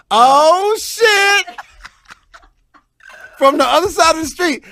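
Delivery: high, loud shout across the street; starstruck shout. Retold or imitated. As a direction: yell it at the star. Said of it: "I'm the oh shit dude".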